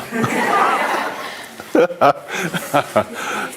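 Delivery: long breathy laugh, falling; long airy laugh. Real, not acted. Amused by his own story.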